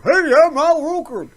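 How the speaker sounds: high, loud goofy TV host voice; cheesy morning-show weatherman greeting; Al Roker impression. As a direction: ham up a jolly weatherman.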